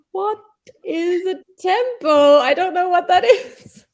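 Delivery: high, loud, baffled voice with a laugh; confused foreigner. Retold or imitated. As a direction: sound completely lost and amused. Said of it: "It's like".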